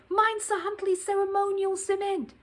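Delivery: urgent cry. Acted. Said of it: "cried Missus Brown".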